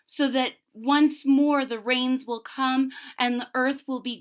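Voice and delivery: story narration. A narrator's reading. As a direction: read it like a legend to a class. Teacher reads story aloud.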